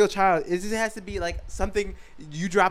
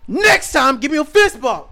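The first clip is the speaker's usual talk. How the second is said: high, loud bullying shout; jokey mock shout at a child; mock threat; 9 semitones higher, 12 dB louder than usual. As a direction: yell it at the kid like a menace. Voicing himself threatening kid.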